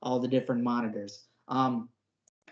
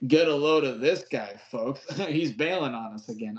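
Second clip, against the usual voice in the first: cheesy leader line. Retold or imitated. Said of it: "he goes".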